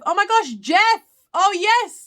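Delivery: high, loud, excited recognition; delighted surprise. Real, not acted. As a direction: greet a familiar viewer with real excitement. Spots a known viewer joining.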